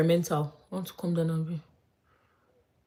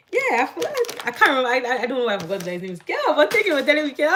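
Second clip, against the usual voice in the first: excited; 8 semitones higher, 5 dB louder, faster than usual. Real, not acted. Excited about remembering the name Caleb.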